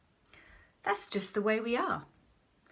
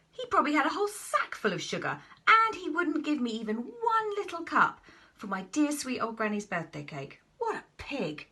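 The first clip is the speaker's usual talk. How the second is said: indignant rant; 8 semitones higher than usual. Acted. Wolf ranting about the pig.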